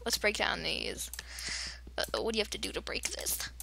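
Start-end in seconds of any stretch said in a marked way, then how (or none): 2.0-3.5 quick softer whisper. Real, not acted. Gamer whispering a question to herself, whispered. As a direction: whisper it fast to yourself.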